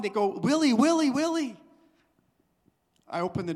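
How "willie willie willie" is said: higher, louder calling out a name; imitating villagers shouting his name; mock villagers' shout. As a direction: call the boy's name like an excited crowd. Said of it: "started shouting and pointing".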